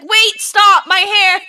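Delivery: high, loud yell, slowing on the last words; panicked yell. Retold or imitated. Hair caught in the paddle wheel, yelling at friends to stop. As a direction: shout for them to stop the wheel. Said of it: "I genuinely thought".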